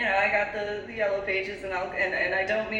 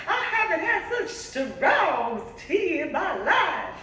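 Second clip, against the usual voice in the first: put-on Mary's Georgia accent; 13 semitones higher, 5 dB louder than usual. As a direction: do Mary's Georgia accent, aghast at strong tea. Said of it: "if I'm on point with that accent".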